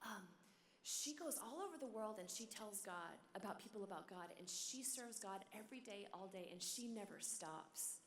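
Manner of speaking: put-on soft, hushed mother explaining to her child; mother rescuing. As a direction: gently explain to a child, quiet and slowing down. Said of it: "she kinda rescues the situation".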